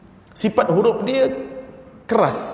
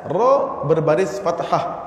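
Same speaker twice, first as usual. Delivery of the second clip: ordinary delivery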